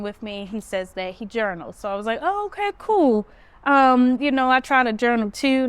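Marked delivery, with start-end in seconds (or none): 2.2-3.3 much higher, agreeable "oh, okay"; high-pitched polite reaction. Retold or imitated. Reacting to a customer who says he journals, high-pitched and agreeable. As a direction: go up high, playing along politely.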